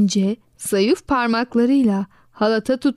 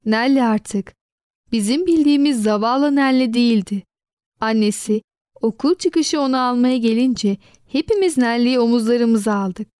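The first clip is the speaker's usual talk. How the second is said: ordinary delivery, nothing marked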